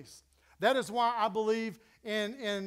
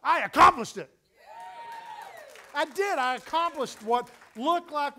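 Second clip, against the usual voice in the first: louder, higher triumphant cry; triumphant shout; 7 semitones higher, 7 dB louder than usual. Real, not acted. Preacher proclaiming he accomplished the task, triumphant shout. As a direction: shout the victory out.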